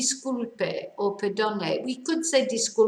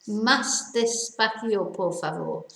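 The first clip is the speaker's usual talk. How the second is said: slow model phrase. Shown as a demonstration. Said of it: "teacher repeats the phrase slowly".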